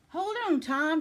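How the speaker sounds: high, loud urgent shout. A narrator's reading. Grandude shouts to fallen Tom, urgent shout. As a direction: shout to someone in danger. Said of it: "shouted Grandude".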